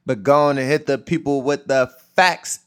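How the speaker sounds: loud, high hype-man send-off; hyped hand-off. Real, not acted. Host cueing his friend to hit the people with the facts, hyped.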